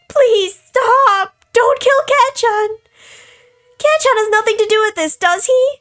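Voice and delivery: high, frantic begging at full voice; screamed pleading. A narrator's reading. Captive begs the killer to spare the boy, screamed pleading. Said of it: "She screamed at me not to kill".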